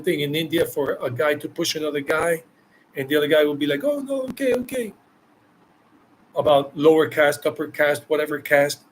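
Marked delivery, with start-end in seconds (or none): 3.8-4.9 hammed-up timid retreat; mock meek backing down. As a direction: mimic a pushed man giving in. Pushed guy backing down, voiced.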